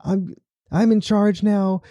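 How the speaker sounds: a lieutenant losing it on the radio; freaking out. Retold or imitated. Lieutenant panicking to the colonel, high and frantic. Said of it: "He's freaking out".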